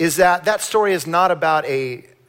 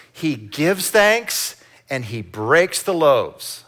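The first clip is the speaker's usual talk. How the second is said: slow emphatic; much slower than usual. Shown as a demonstration. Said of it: "Let's say that with me".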